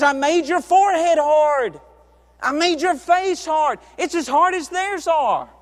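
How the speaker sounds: preacher thundering God's promise; booming voice of God. Acted. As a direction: proclaim it like God speaking. Preacher voicing God, building.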